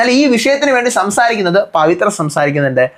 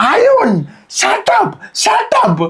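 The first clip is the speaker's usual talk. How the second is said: much higher, squeaky mock "shut up"; mock sudden outburst; 12 semitones higher, much slower than usual. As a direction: leap in and squeal it at Arun. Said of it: "ചാടി നിന്നിട്ട്". (അരുണിനോട് പെട്ടെന്ന് ചാടിക്കയറി കീച്ചിയ ശബ്ദത്തിൽ shut up)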